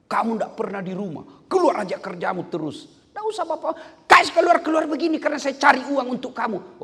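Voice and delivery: a wife scolding her always-absent husband, mock angry; wife nagging. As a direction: play the scolding wife, climbing into a shout. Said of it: "Kalau bapak-bapak marah istrimu".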